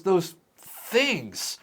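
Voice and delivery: high, hesitant dread; anxious pleading. Acted. Peter warning Chet about exposure, anxious pleading. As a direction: stumble nervously into naming the horror. Acted coworker refusing Chet entry.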